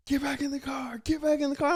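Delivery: high-pitched, strained yell held back in volume; mock yell. Officer ordering driver back into the car, retold mock yell. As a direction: imitate a yelled order in a high, thin voice. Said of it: "he just starts yelling".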